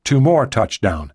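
ordinary delivery

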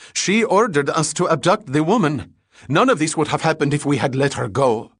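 high, loud desperate begging; panicked pleading. A narrator's reading. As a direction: plead for your life. Struggling man pleading desperately.

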